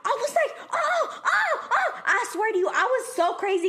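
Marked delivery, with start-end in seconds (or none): 0.7-2.0 high-pitched mock-shocked gasps of "oh"; repeated high "oh" of disbelief; outraged "oh". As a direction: replay the shock in short, very high ohs. Acting out her outraged shock.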